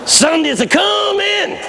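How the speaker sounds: high, long-drawn preacher's cry; climactic refrain. Retold or imitated. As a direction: proclaim it triumphantly, stretched out.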